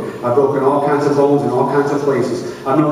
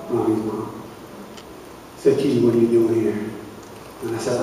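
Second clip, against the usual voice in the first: sad and subdued; 7 semitones lower, 5 dB quieter than usual. Real, not acted. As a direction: lower and softer, heavy. Recalling tears and rejection.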